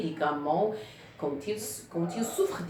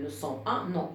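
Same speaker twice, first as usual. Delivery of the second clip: ordinary talk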